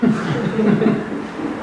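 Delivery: a high amused laugh. Real, not acted. Laughing at kids' reluctance. (아이들 모습을 떠올리며 웃는 웃음)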